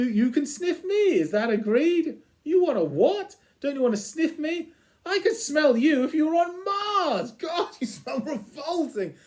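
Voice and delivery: a bouncy dog begging to play; the dog sniffing the Cat, overexcited; excitable dog. Retold or imitated. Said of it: "And the dog says".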